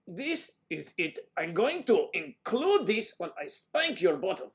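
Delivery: put-on loud, forceful German accent, pitched high. Performer showing off a German accent on request, forceful and loud. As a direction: bark it out in a heavy, deliberate German accent. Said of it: "can we hear a German accent?".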